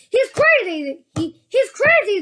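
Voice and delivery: high, loud panicky shouts; frightened shouting. A narrator's reading. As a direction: shout in alarm. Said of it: "they shouted".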